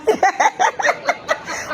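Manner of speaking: airy high laugh; breathy mocking laugh. Real, not acted. Host laughing at fleeing opponents, airy mocking laugh. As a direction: laugh at them without voice.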